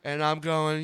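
ordinary talk

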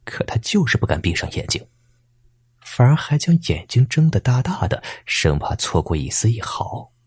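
usual speaking voice

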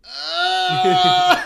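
loud high agonized yell; pained yell. Retold or imitated. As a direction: howl in pain like you were just hit. Roommate groaning after car hit.

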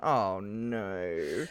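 quiet, low, dismayed "oh no"; a soft, deflated groan at the screen. Retold or imitated. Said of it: "I just paused the movie and I just went".

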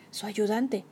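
ordinary delivery, nothing marked

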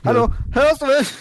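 high, loud call down a line; shouting on bad phone line. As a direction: shout as if they can't hear. Mock bad phone call check.

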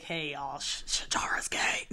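whispered announcement; mock whisper. Dad not announcing his daughter's secret, whisper. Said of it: "Daddy wasn't like".

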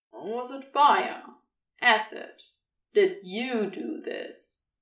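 ordinary delivery, nothing marked

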